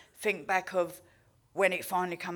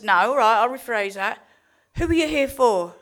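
louder, higher, firm questioning; firm counsellor; 4 semitones higher, 9 dB louder than usual. Retold or imitated. Counsellor to Sinead, asking who she is here for, loud and firm. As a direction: press her for the real answer.

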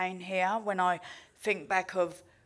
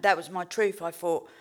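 normal talking voice

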